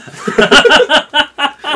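loud, high laughing burst; burst of laughter. Real, not acted. Cracking up as the demo piece breaks, loud laugh.

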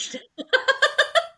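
high laugh at her own joke; burst of laughter. Real, not acted. Laughing after joking that she drinks now, high burst of laughter. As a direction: laugh it off, amused at herself.

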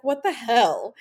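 mock outrage. Friend reacts to him leaving.

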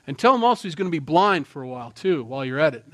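loud, fast furious command; preacher voicing God, loud and furious. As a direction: boom out the Holy Spirit's angry orders to Paul. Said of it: "'cause I'm furious".

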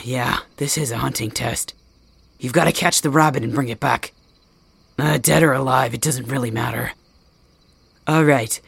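ordinary talk, nothing marked

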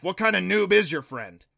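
put-on voice, loudly indignant; exasperated scoff. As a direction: scoff loudly at a clueless newcomer. Creature scoffs at the friend.

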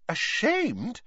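blank puzzlement. A narrator's reading. Hermione echoes Winky's word, blankly puzzled. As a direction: repeat it as if baffled. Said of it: "said Hermione blankly".

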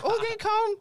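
high, bright mimicry of an older relative; imitating relatives. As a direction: squeal it like a surprised auntie. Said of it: "so they be like".

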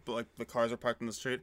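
usual speaking voice

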